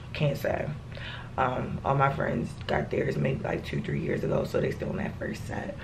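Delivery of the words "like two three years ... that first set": unvoiced hushed whisper. Real, not acted. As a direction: drop to a whisper. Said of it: "talk like this".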